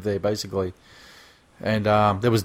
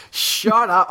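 retold yell; 8 semitones higher than usual. Driver retelling his reaction in the car, mock yell. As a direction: re-enact an outburst, pitched well up. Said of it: "I actually yelled".

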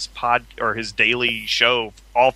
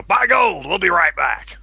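loud, high-pitched radio host spoof; hammed-up radio pitch into the break; Alex Jones; 7 semitones higher, 3 dB louder, slower than usual. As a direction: belt it out like a ranting broadcaster. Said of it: "used to do a character called Richard Lococo".

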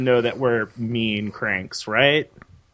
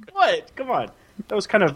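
usual speaking voice

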